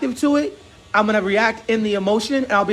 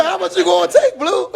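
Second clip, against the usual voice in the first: teasing quote; 8 semitones higher than usual. Retold or imitated. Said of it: "He said".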